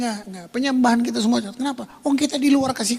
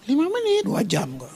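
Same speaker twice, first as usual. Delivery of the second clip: smug comparison; 8 semitones higher than usual. Pretend. Boastful believer compares prayer time.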